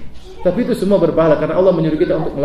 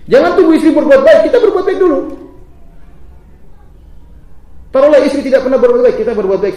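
much higher, louder exhortation; preaching; 9 semitones higher, 6 dB louder than usual. Acted. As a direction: urge them with full force. Preaching at full force. (seruan khotbah yang lantang)

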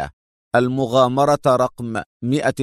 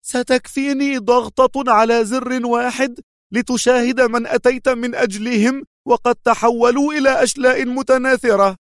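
shaking with rage; 12 semitones higher than usual. A narrator's reading. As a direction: scream the threat. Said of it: "صاحت وجسدها كله ينتفض انفعالا".

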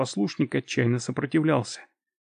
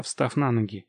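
ordinary delivery

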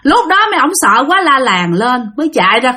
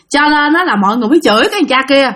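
ordinary talk

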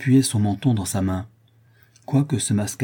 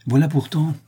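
higher-pitched, solemn scholar; grave learned madman; 7 semitones higher, 4 dB louder than usual. A narrator's reading. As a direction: pronounce it like a self-important mad savant. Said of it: "dit gravement le fou savant". (fou savant, ton grave)

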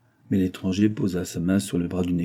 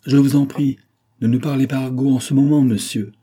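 raised, higher voice in sharp protest; angry rebuke, pitched up and louder; 5 semitones higher, 6 dB louder than usual. A narrator's reading. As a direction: scold him, rising and louder. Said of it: "répondit Sloper en colère".

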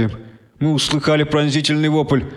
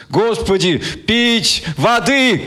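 desperate begging; 8 semitones higher, much slower than usual. A narrator's reading. Wounded man begs for water.

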